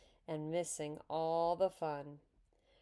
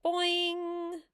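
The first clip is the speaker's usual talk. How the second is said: high, loud sound-effect word; one high, ringing 'boing'; playful yell; 12 semitones higher, 5 dB louder than usual. A narrator's reading. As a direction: yell it like a spring being twanged. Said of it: "tweaked one of Susan's curls and yelled".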